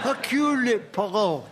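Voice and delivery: hammed-up French accent, slow. Shown as a demonstration. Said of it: "This is my French accent there".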